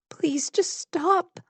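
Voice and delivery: high, slow, crying plea; tearful plea. A narrator's reading. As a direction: beg through tears, voice lifted and slow. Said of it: "She's crying again".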